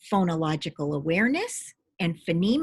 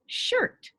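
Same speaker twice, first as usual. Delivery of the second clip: student answer. Retold or imitated. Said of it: "And the student would say".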